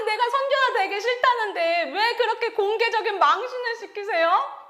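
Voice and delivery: high, loud tearful protest with half-laughing edges; yelling at God. Retold or imitated. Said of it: "따지고 막 소리를 지르고". (하나님께 높고 크게 따지며 소리치는 재연)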